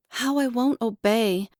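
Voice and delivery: plain reading voice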